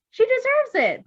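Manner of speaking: high, loud arguing. Acted. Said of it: "the doctor argued".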